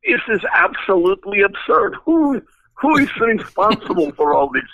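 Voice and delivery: imitating a thick Russian accent, pitched high and indignant; Zorro's Russian accent. Zorro storms into the studio and confronts the designers, thick Russian accent. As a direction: do an outraged Russian engineer, voice up high. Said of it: "big, thick Russian accent".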